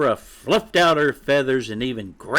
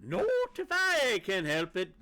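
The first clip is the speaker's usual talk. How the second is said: fierce clucking; 9 dB quieter than usual. A narrator's reading. Mother shooing chick away from friend, fiercely. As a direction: cut in with a high, cross cluck. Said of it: "clucked Sapphira fiercely".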